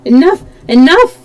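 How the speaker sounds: high, loud outburst; cry out. Acted. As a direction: cry it out in horror. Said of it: "cried Raoul".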